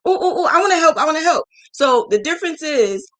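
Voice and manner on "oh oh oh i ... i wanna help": much higher, breathless begging to help; imitating her own over-eager younger self; eager excitement. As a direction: bounce like a kid asking to join in. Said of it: "I was so freaking excited".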